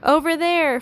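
high, loud call; yell. A narrator's reading. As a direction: yell it across to someone far away. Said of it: "Fox yelled".

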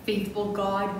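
ordinary talk

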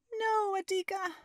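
mother calling after her son; cried out. A narrator's reading. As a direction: call out to the running boy. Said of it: "she cried out".

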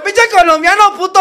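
voz de chilango insultando, mucho más aguda y fuerte; chilango enojado. Retold or imitated. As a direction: suelta el insulto como chilango burlón. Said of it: "Me dijo". (chilango insultando al colombiano, agudo y fuerte)

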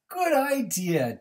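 high, loud, slow praise; praising Duck. A narrator's reading. Animals thanking duck, high happy praise. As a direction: cheer him on.

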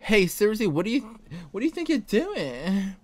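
high, put-on feminine character voice; indignant, high-pitched mock-feminine delivery. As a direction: protest in a high, girlish voice. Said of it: "should I do a little feminine voice for him".

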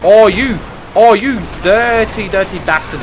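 loud, high outraged cry at a rival driver; angry outburst. Real, not acted. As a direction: yell it out at the guy who rammed you. Rival driver rams him mid-race.

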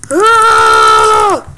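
loud, long yell; battle yell. Pretend. Player knocking a rival off, loud battle yell. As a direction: let out a loud attacking holler.

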